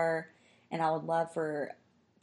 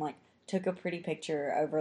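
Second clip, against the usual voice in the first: usual speaking voice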